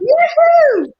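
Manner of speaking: high, loud sing-song call; sing-song yoo-hoo. Pretend. Cheerfully greeting her own chaotic thoughts, high sing-song call. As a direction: call out brightly like greeting a friend.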